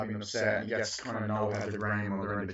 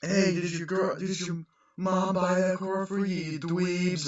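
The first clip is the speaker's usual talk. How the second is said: jock taunt; 8 semitones higher, slower than usual. Retold or imitated. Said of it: "the assholes go".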